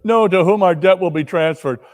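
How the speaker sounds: words through relieved laughter; laughing husband. Acted.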